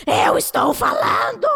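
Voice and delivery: high, drawn-out exasperated yell; mock shout. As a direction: act out yelling to be heard, stretching the words. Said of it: "começa a gritar e fala". (grito encenado, agudo e arrastado)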